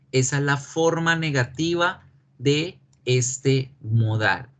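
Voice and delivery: usual speaking voice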